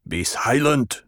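ordinary delivery

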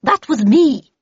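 loud, high shout back; yelled. Acted. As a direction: yell the admission up to the deck. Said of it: "yelled Havisham".

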